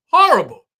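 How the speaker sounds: high, loud exclaim of "Horrible."; shouted horrible. Real, not acted. Host condemning the cast's friend group, loud outburst. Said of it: "I just gotta say it like that".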